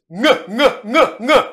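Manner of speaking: "nge" with the ng of sing, not a plain n; velar nasal nge. Shown as a demonstration. Teacher drilling the velar nasal for students. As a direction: repeat "nge" from the back of the mouth. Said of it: "Ce n'est pas un ne".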